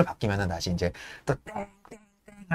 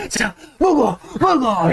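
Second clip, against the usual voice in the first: much higher, coaxing; coaxing stoker; 10 semitones higher, much slower than usual. Retold or imitated. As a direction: urge the furnace to eat. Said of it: "이러면서 하고 있는 거죠".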